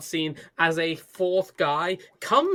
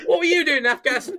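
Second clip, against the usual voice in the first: fast, higher, louder demand; mock interrogator shout; 8 semitones higher, 5 dB louder, much faster than usual. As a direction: bark the question like a thug.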